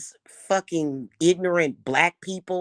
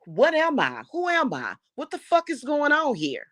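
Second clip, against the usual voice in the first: exasperated outcry; 10 semitones higher than usual. Real, not acted. Venting to viewers about being stalked, rising exasperation. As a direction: pitch climbing higher with each question.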